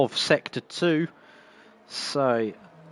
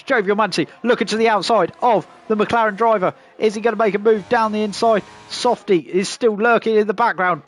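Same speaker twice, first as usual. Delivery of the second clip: higher, lively live race call; motorsport commentator; 4 semitones higher than usual. Acted.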